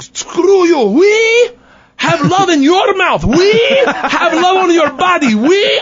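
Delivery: high, slow mock Russian yell; a hammed-up Russian yelling at a cop; yelling Igor. Igor yelling at the police officer, mock high Russian. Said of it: "starts yelling at him like".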